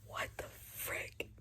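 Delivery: slow, breathless whispered disbelief; stunned whisper. Real, not acted. Reader shocked by a twist, whispering. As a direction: hush it out as if the page betrayed you.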